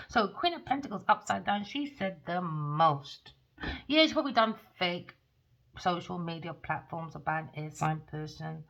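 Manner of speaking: ordinary talk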